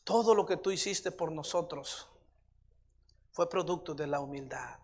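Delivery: hushed, unhurried address to God; prayer. Real, not acted. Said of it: "Vamos a orar".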